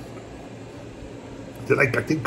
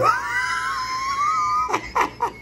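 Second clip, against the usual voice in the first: shrieking laugh; 4 dB quieter than usual. Real, not acted. Excited shout after greeting.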